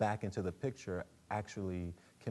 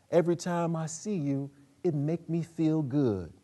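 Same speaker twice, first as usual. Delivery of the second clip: put-on higher, louder elderly advisor's voice; Aunt Ester; 8 semitones higher, 7 dB louder than usual. Old advisor teasing her suitor about love, raised voice. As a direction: speak as the old spiritual advisor, fond and teasing. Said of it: "Aunt Hester".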